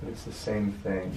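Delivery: ordinary talk, nothing marked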